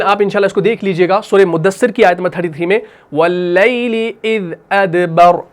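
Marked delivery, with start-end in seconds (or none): 3.1-5.5 slow, drawn-out tajweed recitation; Quran recitation. Shown as a demonstration. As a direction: recite it slowly and melodically. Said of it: "तो मैं यहाँ पर लिख देता हूँ".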